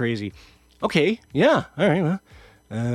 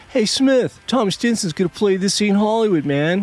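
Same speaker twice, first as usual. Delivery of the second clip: higher and louder, hyped-up friend; excited buddy; 9 semitones higher, 4 dB louder than usual. Retold or imitated. [name] tells [name] [name] is playing, high and excited. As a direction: pump it up like breaking big news.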